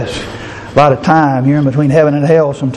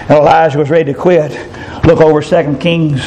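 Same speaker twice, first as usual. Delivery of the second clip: ordinary talk, nothing marked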